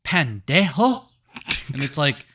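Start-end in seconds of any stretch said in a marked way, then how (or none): 0.0-1.1 put-on snapping high and loud with an accent; hammed-up scolding woman; mock Mexican woman. Woman at the post office scolds the guy, loud mock snap. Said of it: "She goes".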